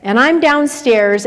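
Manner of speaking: ordinary talk, nothing marked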